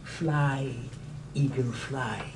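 hushed plea to soar; quiet command. Acted. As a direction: release the bird gently. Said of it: "and says".

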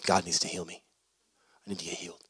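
low, hushed, dejected plea; downcast. Acted. Anderson tells his visitor he needs healing, downcast and hushed. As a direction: sink to a very soft, low voice. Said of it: "Anderson has his head low".

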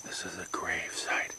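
hushed whisper at a grave. Real, not acted. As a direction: whisper it respectfully.

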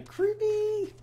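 high drawn-out squeak at a creepy monster; squeaky creeped-out. Real, not acted. Disgusted at ugly tentacle monsters.

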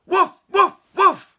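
high, loud yapping woofs; barking dog. Acted. Dog arriving among the cats, loud barking. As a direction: bark each woof sharply.

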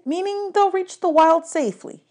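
high, reassuring satyr voice; high goat-boy explaining; Grover voice. A narrator's reading. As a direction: explain it brightly to a friend.

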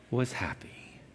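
soft, low near-whisper; hushed ending. A narrator's reading. Narrator closing the tree's story, hushed and tender. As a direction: let the last words fall to a whisper.

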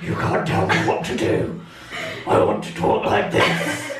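very low, rasping bear growl; defiant growl from the throat, slowing down; Daddy Bear voice. Retold or imitated. Child defying a warning about their throat, in a growling Daddy Bear voice. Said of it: "gonna do that voice anyway".

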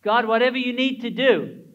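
loud, high fervent prayer; crying out. Acted. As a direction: call out to God in surrender. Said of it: "I cry out to you".

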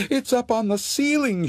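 put-on a child calling for her parents; frightened girl. Sarah telling her parents about the ghost, high frightened voice.

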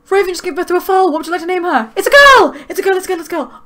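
high, loud rush peaking on a shriek, then easing; thrilled outburst. Real, not acted. Player learning the foal is a girl, shrieking with excitement. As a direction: announce a birth in breathless delight.

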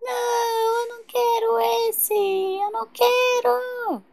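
high-pitched, dragged-out tantrum wail; irritating baby cry. Pretend. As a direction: cry the refusal like a fussy baby. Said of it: "esse choro dela é tão irritante". (choro irritante de bebê birrento)

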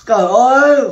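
a loud, exasperated oh my god; loud exclamation. Real, not acted. Reacting loudly to a viewer's silly question. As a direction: throw out a loud groan of disbelief.

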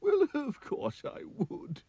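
high, wailing king sobbing oh dear; crying king. Acted. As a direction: wail like a weepy king. King answers.

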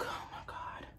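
breathless whisper of dread. Retold or imitated. Slipping by the pool, a whispered oh my God. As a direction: hush it out as you feel the fall coming.

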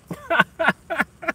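a shrill, squealing burst of laughter; high squealing laugh. Real, not acted. Laughs after oh shit.

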